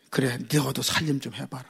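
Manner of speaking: soft, coaxing wifely answer; gentle wife's reply. Retold or imitated. Wife gently answering a complaining child, soft. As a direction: say it softly, a wife calming her husband. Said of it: "여자에다가 니은을 해가지고".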